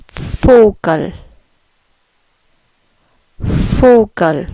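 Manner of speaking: Vogel with the V said as an F, falling; German Vogel. Shown as a demonstration. Teacher drilling Vogel for learners, careful German pronunciation. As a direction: say Vogel starting on a clear F sound. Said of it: "the V makes an F sound".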